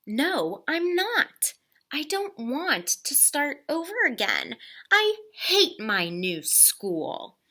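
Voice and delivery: put-on high, whiny child protest. Girl protesting to her father, high whiny complaint. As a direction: plead like a sulky kid.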